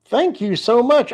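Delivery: high, bright rising thank you so much; excited thanks. Real, not acted. Thanking a donor on stream.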